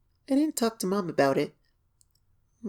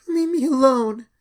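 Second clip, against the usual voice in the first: louder frightened cry to leave; shout; 7 semitones higher, 9 dB louder than usual. Acted. Scared filly yells at the knocker to leave her alone. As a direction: yell it at the door. Pony imagines yelling at stranger.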